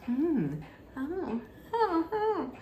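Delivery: a flustered little reaction; mock nervous surprise. [name] noticing Justin arrive, quietly flustered.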